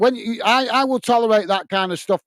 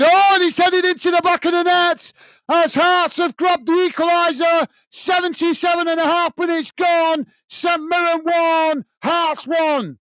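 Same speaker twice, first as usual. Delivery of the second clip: much higher, shouted, soaring goal call; excited goal call; 11 semitones higher than usual. Real, not acted. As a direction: roar the equaliser to the viewers. Goal called in live commentary.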